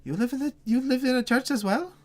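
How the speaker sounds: put-on boy thrilled by the news; eyes lighting up. Boy excitedly asking the adventurer about the church. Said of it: "his eyes light up slightly".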